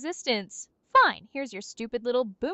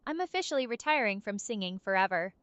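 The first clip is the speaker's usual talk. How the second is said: normal talking voice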